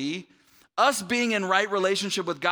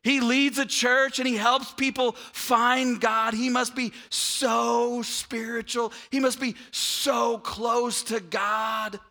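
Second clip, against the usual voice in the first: high, loud, hammed-up awe with long stretched words; mock gushing admiration; 5 semitones higher, 3 dB louder, slower than usual. Preacher imitating admirers of his godliness, exaggerated awe. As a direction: overdo the praise, dragging out each "so".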